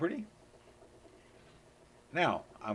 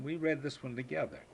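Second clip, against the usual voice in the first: usual speaking voice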